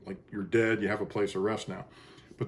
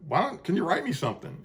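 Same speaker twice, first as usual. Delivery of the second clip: asking higher; 7 semitones higher than usual. Retold or imitated. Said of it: "And I just said".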